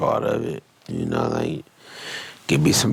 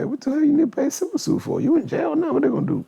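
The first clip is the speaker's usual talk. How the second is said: pitched way up, fast, disbelieving; incredulous rant; much faster than usual. Real, not acted. Ranting at [name] about paying civil suits, high and fast. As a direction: hit it high and fast like you can't believe it.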